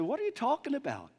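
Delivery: a hint of a concerned stranger asking; curious stranger. Stranger questions the two troubled disciples, lightly voiced. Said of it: "he looks at him and said".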